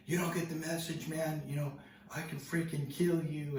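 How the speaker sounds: high, strained mock threat; retold angry threat. Scary stranger threatening to kill the teller, high-pitched retold anger.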